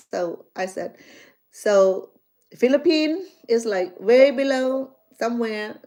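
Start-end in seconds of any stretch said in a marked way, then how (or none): 1.5-5.8 mock lecturing retort. Teasing retort about geography.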